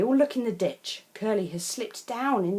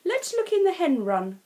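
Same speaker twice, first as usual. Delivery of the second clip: high, louder brisk suggestion; brisk farmer; 10 semitones higher, 6 dB louder than usual. Acted. Farmer leading the children to the hen run, brisk and high. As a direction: lead the search.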